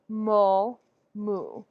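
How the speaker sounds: ordinary talk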